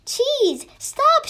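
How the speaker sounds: high, urgent cry of Stop; shout. A narrator's reading. Town Mouse warns his friend off the trap, shouting. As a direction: yell to stop him in time. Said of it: "shouts Town Mouse".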